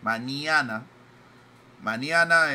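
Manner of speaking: Mañana as "maniana", spelling out n and i; argentine style. Shown as a demonstration. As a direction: stress maniana, no ñ sound. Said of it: "dicen N I A". (Mañana dicho "maniana", sin ñ)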